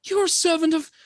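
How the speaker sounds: high, loud spirit voice; an angry water spirit calling out; unearthly voice. Acted. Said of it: "this spirit's voice calls out".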